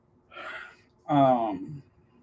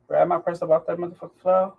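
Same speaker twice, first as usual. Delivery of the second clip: ordinary talk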